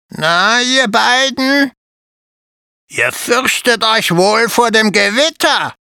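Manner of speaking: lightly voiced rough spider greeting; raspy croak. A narrator's reading. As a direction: croak it out like a curious old spider. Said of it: "krächzte plötzlich jemand mit rauer Stimme".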